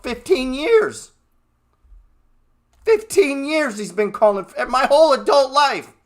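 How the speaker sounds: exasperated rant. Real, not acted. Trader venting at a guru's crash calls, loud exasperation. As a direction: blow up in disbelief at the guru. Ranting about Robert Kiyosaki.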